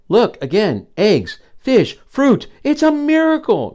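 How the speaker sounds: mother hyena thrilled at the feast; excited exclaim. Acted. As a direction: sell the miracle with delight. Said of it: "exclaimed Mama".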